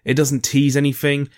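normal talking voice

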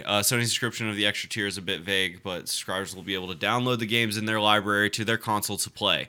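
reading the article aloud in a steady report voice; news article reading. Acted. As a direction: read it like a news piece.

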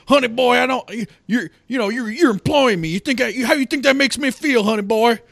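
high, loud mock Southern drawl with stumbling starts; Shia LaBeouf's southern drawl. Film dad protests to the son employing him, mock southern drawl. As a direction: lay on a hammed-up Southern accent, pitched high. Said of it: "southern David Foster Wallace".

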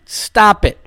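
normal talking voice

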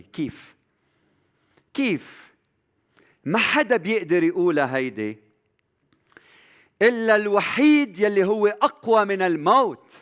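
high, loud, mounting sermon delivery; fervent preaching. Acted. Preacher to his brothers on God's compassion, fervent and loud. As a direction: preach it hard, building each line.

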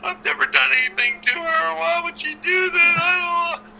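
high, whimpering mock sob; high teary imitation of a crying colleague; imitating crying Goldilocks. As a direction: whine it out like a tearful, bewildered wrestler. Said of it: "Goldilocks was crying".

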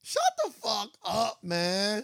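slow, stunned shut the fuck up; shocked disbelief. Real, not acted. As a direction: drag it out in disbelief. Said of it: "shocked reaction to story".

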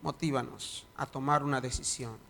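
prayer swelling to loud preaching; fervent prayer. Acted. Pastor praying aloud over the congregation, fervent prayer. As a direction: pray with growing fervour.

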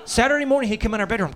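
usual speaking voice

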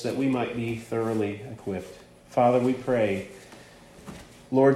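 soft, low, measured prayer; hushed opening prayer before a sermon. Real, not acted. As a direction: pray quietly and reverently, pausing between phrases. Leads a prayer before preaching.

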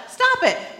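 laughing through a curt order; playing the therapist, amused and blunt. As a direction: snap it short, half laughing. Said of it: "his advice is two words".

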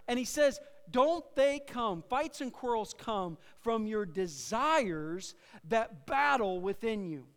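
projected, insistent preacher delivery; emphatic preaching. Real, not acted. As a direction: admonish the congregation from the pulpit. Preaches James's rebuke.

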